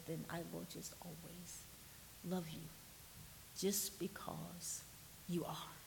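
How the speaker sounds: tender reassurance. Retold or imitated. Aunt telling her little nephew she loves him, tender. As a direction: reassure a child gently, letting each phrase settle.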